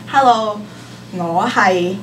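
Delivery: normal talking voice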